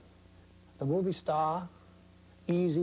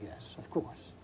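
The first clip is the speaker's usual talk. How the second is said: breathy whispered aside, lower; 5 semitones lower, 8 dB quieter, slower than usual. Real, not acted. Veteran photographer admitting he'll go out again, a whispered aside. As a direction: let it slip out as a secret. Sly aside about farewell tours.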